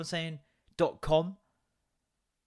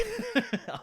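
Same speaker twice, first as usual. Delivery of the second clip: high burst of laughter; 5 dB quieter than usual. Real, not acted. Laughing at own joke.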